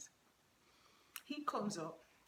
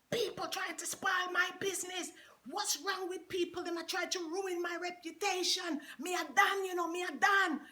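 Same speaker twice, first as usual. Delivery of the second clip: Jamaican rant; 8 semitones higher than usual. Retold or imitated. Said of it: "he starts ranting over the mic".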